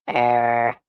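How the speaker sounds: ordinary delivery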